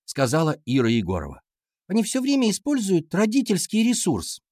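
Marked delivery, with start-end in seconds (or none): 1.9-4.4 put-on high-pitched girl making excuses; schoolgirl class monitor. A narrator's reading. Class monitor explaining the rivals' wins to teacher, high girlish voice. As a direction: pipe up high, blaming the rivals.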